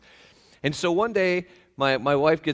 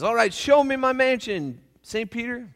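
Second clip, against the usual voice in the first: eager demand; 10 semitones higher, 5 dB louder than usual. Acted. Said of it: "the pastor goes up to Saint Peter and says".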